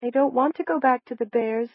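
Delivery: high, timid plea; high-pitched, fearful reluctance. A narrator's reading. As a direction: plead timidly in a high little voice. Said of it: "Sonny's timid voice asked".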